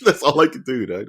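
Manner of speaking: usual speaking voice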